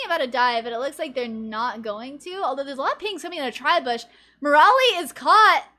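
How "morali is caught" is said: excited. Real, not acted. Sudden pick during casting.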